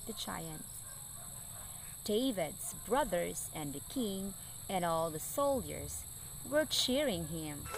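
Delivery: gentle, soft storybook reading; story narration. A narrator's reading. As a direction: read it like a bedtime story for kids. Reading Bible story to children.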